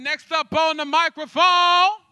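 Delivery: rising, loud, stretched-out stage call; announcer holler. Real, not acted. Host summoning the next poet, hollering. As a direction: call out to the whole room.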